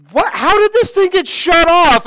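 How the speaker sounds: high, loud startled nurse; alarmed nurse. Retold or imitated. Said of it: "And the nurse is like".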